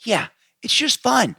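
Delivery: high, slow gushing; excited. Real, not acted. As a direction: squeal it out with delight. Gushing about the movie.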